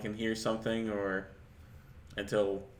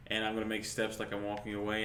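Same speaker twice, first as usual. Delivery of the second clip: ordinary talk, nothing marked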